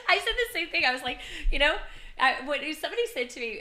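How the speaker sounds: can't stop laughing while telling it; giggly, breathless retelling. Real, not acted. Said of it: "And I don't mean to laugh".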